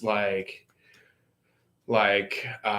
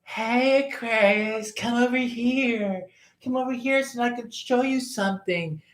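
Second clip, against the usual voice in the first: high, sing-song calling voice pitched well up; high coaxing holler; hollering lure; 10 semitones higher, 4 dB quieter than usual. Retold or imitated. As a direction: call them over sweetly in a high voice. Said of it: "hollering at you".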